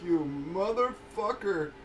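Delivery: drawn-out slow retold curse; slow, weary mock curse; slow disgusted curse. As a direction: drag it out slowly in mock disbelief. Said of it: "and I was just like".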